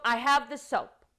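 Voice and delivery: mock-annoyed kid insisting; mild exasperated child; impatient child reply. Child's impatient replies to mother.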